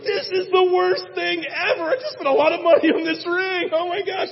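high, loud dismayed rant; dismayed groom lamenting the ring money, high and loud; dismayed panic. Retold or imitated. Reaction to reading "Divorce.

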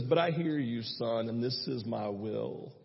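low, soft and slow fatherly reassurance; God reassuring his son, slow, low and soft; gentle voice of God. Retold or imitated. Voicing God's reply.

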